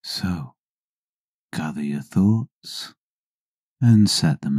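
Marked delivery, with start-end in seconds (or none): none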